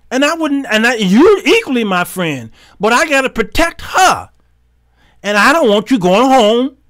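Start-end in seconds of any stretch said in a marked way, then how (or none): none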